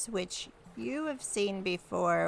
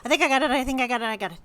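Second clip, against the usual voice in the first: fast, louder, eager babble; excited rush; 4 semitones higher, 8 dB louder, much faster than usual. Acted. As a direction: rattle it off, thrilled you've cracked it. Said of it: "Marigold's like".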